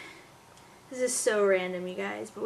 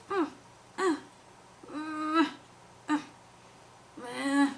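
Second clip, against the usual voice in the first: put-on short waking-up baby grunts; small grunts with gaps, like a baby stirring; imitated baby grunts; 4 semitones higher, 4 dB quieter, slower than usual. Mom showing how her baby wakes up, imitated grunting. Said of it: "This is how she wakes up. She goes".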